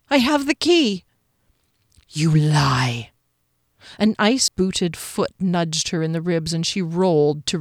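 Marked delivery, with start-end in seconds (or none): 0.1-1.0 high, frightened reply; pleading, pitched well up; anxious defence. A narrator's reading. Captive defends herself to guard. 2.1-3.1 low, drawn-out accusation; ominous low voice; menacing guard. A narrator's reading.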